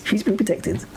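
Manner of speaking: high-pitched challenge; incredulous. Real, not acted. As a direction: ask it high, in open disbelief. Repeats rhetorical question after laughing.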